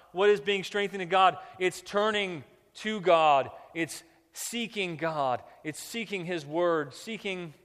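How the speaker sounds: forceful, deliberate sermon delivery; emphatic preaching. Real, not acted. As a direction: drive each point home to the congregation.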